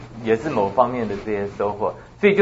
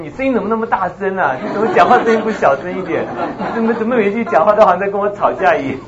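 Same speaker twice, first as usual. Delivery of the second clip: higher and louder, hammed-up nagging; mock scolding complaint; 9 semitones higher, 6 dB louder than usual. Imitating people who say her voice is too loud, mock scolding. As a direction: play someone griping she talks too loud, laughing through it. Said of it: "那我太凶".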